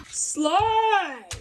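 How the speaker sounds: high, loud playful shout; playful call. Real, not acted. Cook calling out as she slides it. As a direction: sing out the slide.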